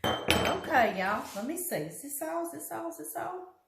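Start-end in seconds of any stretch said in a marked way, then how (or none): 1.8-3.6 fading question. Real, not acted. Repeated, getting quieter and higher.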